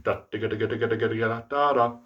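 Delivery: "digga" rattled off evenly after a first "Da"; quick run of "digga" landing on "da, da, da"; double-tongued tune rhythm. Shown as a demonstration. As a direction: sound out a brass rhythm on "digga". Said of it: "learn how to double tongue".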